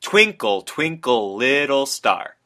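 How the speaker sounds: "Twinkle" stressed on "Twin", then "little star" slowed right down; sing-song the first beats strong, trail off slowly; trochaic rhythm. Shown as a demonstration. Teacher reciting a nursery rhyme to show trochaic stress. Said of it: "when you actually hear it out loud".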